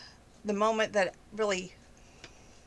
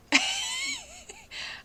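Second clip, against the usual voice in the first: real laugh. Real, not acted. Laughs at Beth's Zumba line.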